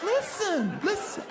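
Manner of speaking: very high, whiny mock nagging; nagging woman. Mocking a nagging girlfriend, very high and whiny. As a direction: screech it like a nag.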